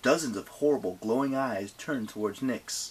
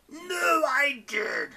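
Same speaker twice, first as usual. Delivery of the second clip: high whiny protest; squabbling; 3 dB louder, much slower than usual. Acted. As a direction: cry out over the others. Quarrelling children.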